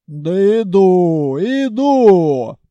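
loud, slow rough holler; orc-like voice. A narrator's reading. Khalya calls back from the side door, loud orc-like holler. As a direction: shout back like a grumbling orc. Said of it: "по голосу я ожидал, что выйдет орка".

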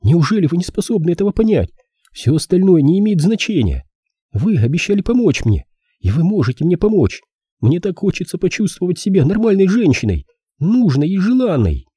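put-on high-pitched pleading girl; young woman character. Girl wants to feel desired, high pleading.

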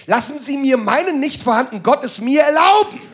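normal talking voice

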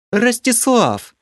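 high, slow, drawn-out irritated call of his name. A narrator's reading. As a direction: call him out sharply, annoyed and surprised. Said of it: "В голосе Елены зазвучали нотки раздражения". (раздражённо и удивлённо)

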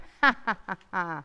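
short witch's cackle; mocking laugh. [name] cackles, mocking the deaf magician. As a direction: cackle at the old man.

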